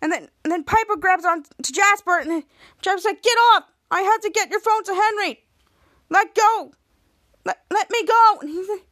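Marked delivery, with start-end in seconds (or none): 3.2-8.4 raised, louder protest; acted struggling yell. Boy grabbed while fleeing with phone, urgent mock yell. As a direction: shout to be released.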